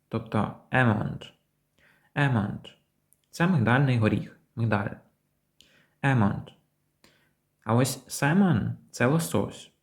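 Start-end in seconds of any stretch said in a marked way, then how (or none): 0.7-2.6 "almond" said without any L; silent L. Shown as a demonstration. Teacher shows learners the word almond, silent L. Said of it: "ми не вимовляємо букву L". 8.1-8.7 "salmon" said without L; silent L. Shown as a demonstration. Teacher introduces the fish word, silent L. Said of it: "Буква L не вимовляється".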